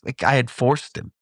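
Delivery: usual speaking voice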